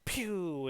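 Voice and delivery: playful pew sound effect; a little toy shot, voiced; mock shot sound. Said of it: "and she goes".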